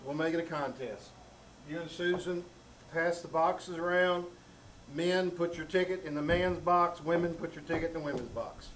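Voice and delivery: ordinary talk, nothing marked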